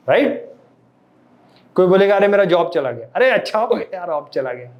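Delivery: ordinary talk, nothing marked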